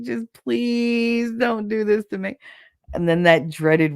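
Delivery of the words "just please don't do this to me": high, drawn-out begging; pleading. Retold or imitated. Woman begging God not to be pregnant, pleading. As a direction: stretch out "please" in a mock whine. Acts bargaining plea to God.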